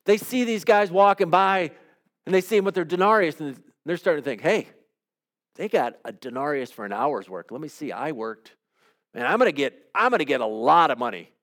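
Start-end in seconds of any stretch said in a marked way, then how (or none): 4.4-11.3 grumbling worker's inner voice. Acted. All-day worker sizing up his pay, low and hushed. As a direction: lower and softer, as if thinking to himself.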